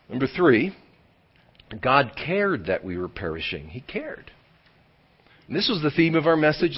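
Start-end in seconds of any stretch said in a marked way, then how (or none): 1.8-4.3 God cared that we were perishing said low and soft, cared leaned on gently; quiet statement. Acted. Preacher introducing point three to the congregation, low and hushed. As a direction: murmur the point like a confession.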